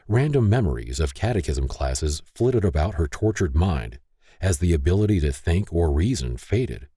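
usual speaking voice

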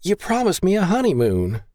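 high-pitched whiny complaint; high, petulant whine; whining. A narrator's reading. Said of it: "she whined".

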